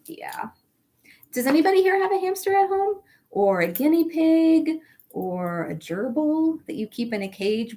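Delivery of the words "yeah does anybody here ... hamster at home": plain reading voice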